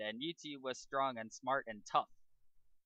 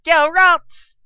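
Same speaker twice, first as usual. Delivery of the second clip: much higher, full-lung shout of a command; battle shout; 22 dB louder than usual. Acted. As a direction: yell it across the arena. Trainer sends out Pokemon.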